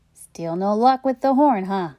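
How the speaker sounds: plain reading voice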